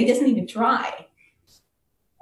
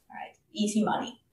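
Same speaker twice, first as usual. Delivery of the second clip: whispered, quick aside; sly whisper; 6 dB quieter, faster than usual. Acted. Merchant gloating to himself about easy money, whispered. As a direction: murmur it like a secret gloat.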